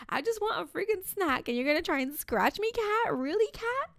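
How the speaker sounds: rising high into mock disbelief; incredulous rant. Venting at swiping cat.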